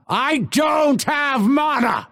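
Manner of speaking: high-pitched frustrated yell; exasperated shout. Acted. Fighter out of mana, exasperated shout. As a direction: shout it at the top of the range.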